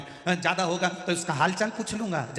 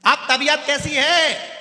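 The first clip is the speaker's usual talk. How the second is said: slow, much higher and louder question; shouting; 11 semitones higher, 10 dB louder, slower than usual. Retold or imitated. Deaf grandfather shouts slowly at the patient to ask how he is. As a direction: bellow the question at a sick man.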